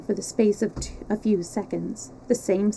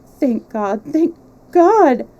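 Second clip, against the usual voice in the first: joyful outcry; 6 semitones higher, 9 dB louder, slower than usual. A narrator's reading. Overjoyed reunion outcry.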